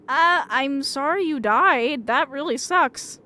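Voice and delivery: sarcastic slow sorry; mock sympathy. Real, not acted. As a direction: fake sympathy dragged out slowly.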